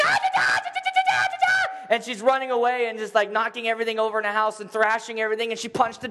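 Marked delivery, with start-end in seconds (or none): 0.0-1.9 very high, shrieking stammer; terrified stammering. Retold or imitated. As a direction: squeal in panic, unable to speak.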